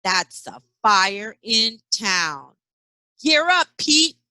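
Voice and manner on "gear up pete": calling out. Acted. Firefighters call to Pete.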